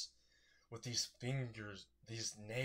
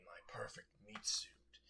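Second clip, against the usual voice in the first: hungry voiceless whisper; creepy whisper; 5 dB quieter than usual. Acted. Sludge villain gloats over the corpse, whispering. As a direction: breathe it out like a gloating predator. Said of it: "He's like".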